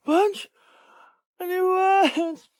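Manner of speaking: high, loud, drawn-out call. Acted. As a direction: call for a sweetheart far away. Calls out for missing partner. (pasigaw na pagtawag)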